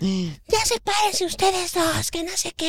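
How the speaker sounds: high, slow scolding granny voice; old lady. Retold or imitated. Old lady separating two fighting boys, high scolding voice. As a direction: pull the kids apart like a fussy old lady. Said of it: "era una doñita".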